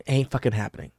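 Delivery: normal talking voice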